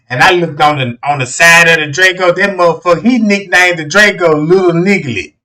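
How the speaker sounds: ordinary delivery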